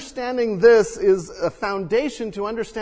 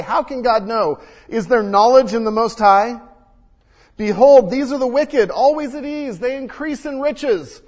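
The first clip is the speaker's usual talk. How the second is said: louder, higher proclaiming of the psalm; scripture read with force; 4 semitones higher, 6 dB louder than usual. Acted. Pastor reading the psalmist's complaint, loud and higher.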